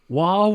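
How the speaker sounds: "Wario." said as "Wawio" with the R turned to a W; a small kid who can't say R yet saying "Wario."; child's lisped Wario. Retold or imitated. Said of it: "had a slight speech impediment".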